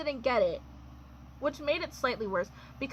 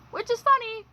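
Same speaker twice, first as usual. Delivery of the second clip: high, louder, choked irony; bitter ironic funny; 11 semitones higher, 6 dB louder than usual. Real, not acted. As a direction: say funny like it hurts. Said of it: "Not funny, ha ha".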